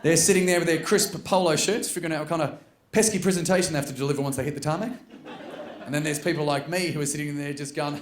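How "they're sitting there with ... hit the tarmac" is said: usual speaking voice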